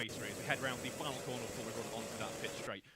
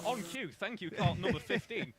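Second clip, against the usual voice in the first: amused excitement; 9 semitones higher, 6 dB louder than usual. Real, not acted. Kart spins right on cue.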